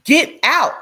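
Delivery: loud, high mock yell; shouting get out. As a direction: yell him out of the house. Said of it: "I was like".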